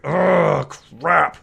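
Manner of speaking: loud, drawn-out acted dismay; exasperated outburst. Commander learns the orcs are lost, loud exasperation. Acted reaction to losing the troops.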